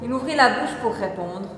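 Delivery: ordinary delivery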